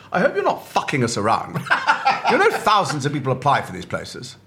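lightly voiced imposing teacher scolding; a hint of a stern posh coach; stentorian voice coach. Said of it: "very stentorian".

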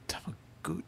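unvoiced trailing whisper. Real, not acted. Trying to remember the teacher's name, whispering. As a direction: whisper while groping for the name. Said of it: "searching for name".

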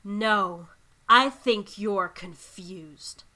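slow, loud, hissed whisper on the curse; vengeful spellcaster. Acted. Bellis turns on the three of you, slow menacing hiss. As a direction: draw the words out like casting a spell. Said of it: "she goes".

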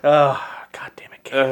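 near-whispered, defeated cursing; hushed, mock-exhausted resignation; imitated weary wife. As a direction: sigh it out under your breath. Acting the exhausted wife answering Gabe.

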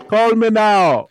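Miss Cleo fake Jamaican accent. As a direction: drawl it like a TV psychic hotline ad. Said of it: "Miss Cleo".

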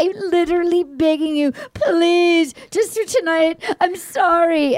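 high mock-tearful begging; hammed-up weepy plea; imitating sobbing pleas. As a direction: plead as if crying. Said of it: "And she's sobbing".

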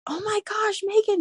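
imitated worried mom, very high, held-back volume; freaking out. Mom learning daughter is returning to work, freaking out. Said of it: "She was freaking out".

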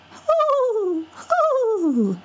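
high, airy dove hooting; dove coo. Acted. Mourning dove call in story.

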